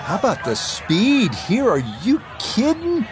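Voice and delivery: high, excited disbelief; amazed. Acted. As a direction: marvel at a stunning putt. Amazed at a long putt.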